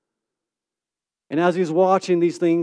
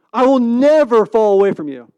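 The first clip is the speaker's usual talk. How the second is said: loud, raised vow with NEVER stretched; emphatic vow; 5 semitones higher, 9 dB louder than usual. Acted. Disciple swearing loyalty to Jesus, emphatic. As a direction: proclaim it loudly, leaning hard on "never".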